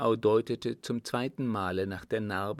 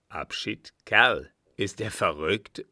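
a disbelieving question rising into a louder cry; exclaiming; 5 dB louder than usual. A narrator's reading. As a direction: exclaim in mild outrage at the subordinate. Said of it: "rief der Marschall". (ungläubig ausgerufen, leicht empört)

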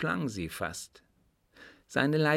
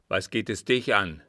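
louder, angry retort; quick and angry; 5 dB louder than usual. A narrator's reading. The suitor answering the man who surprised him, angry. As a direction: snap back angrily. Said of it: "antwortete schnell und zornig".

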